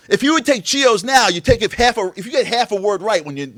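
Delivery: ordinary talk, nothing marked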